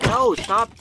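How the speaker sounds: high, loud protest; alarmed shout. Real, not acted. Gamer being killed by a zombie, alarmed shout. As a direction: shout it high as you are attacked.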